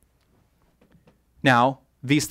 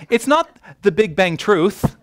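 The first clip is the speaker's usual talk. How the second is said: emphatic denial, landing hard on "truth"; stress on truth; 8 semitones higher, 6 dB louder than usual. Real, not acted. Speaker correcting the audience on wording, stress on truth. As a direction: punch "truth" high and loud.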